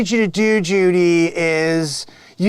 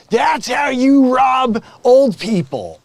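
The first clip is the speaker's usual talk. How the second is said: slow, loud, higher bragging shout; boastful shout; 6 semitones higher, 4 dB louder, slower than usual. Acted. Scam boss brags to Tim, slow loud shout. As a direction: crow it out slowly to the office.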